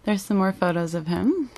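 normal talking voice

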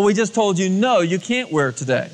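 normal talking voice

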